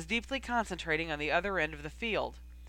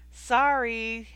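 bright cheerful call, louder; 4 semitones higher, 7 dB louder than usual. A narrator's reading. Harry teasing the rival seeker, cheerful call. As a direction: call it out with mock innocence. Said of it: "Harry called out cheerfully".

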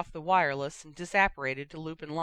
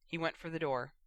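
usual speaking voice

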